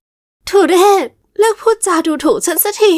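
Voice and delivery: high, slow, strained outburst; mock outraged cry. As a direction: she means to scream but it barely comes. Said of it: "กลับกลายเป็นแค่เสียงกระซิบเบาหวิว". (ตะโกนด่าด้วยเสียงสูงช้าแต่ไม่ดังนัก)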